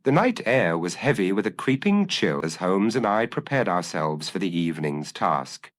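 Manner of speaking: usual speaking voice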